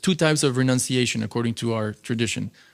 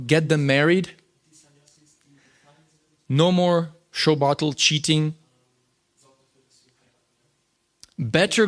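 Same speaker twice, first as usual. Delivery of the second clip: slow, stern, a touch raised; mock angry decree; 4 semitones higher than usual. Quoting angry Prabhupada to the class, slow and mildly stern. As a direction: hand down a firm ban, mildly stern. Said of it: "but here he was angry".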